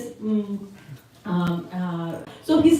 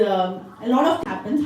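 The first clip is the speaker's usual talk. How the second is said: ordinary delivery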